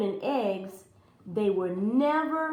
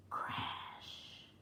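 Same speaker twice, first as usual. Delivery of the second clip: whispered crash; 16 dB quieter than usual. A narrator's reading. Giant hits ground.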